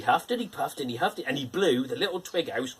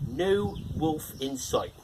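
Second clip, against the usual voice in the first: ordinary delivery, nothing marked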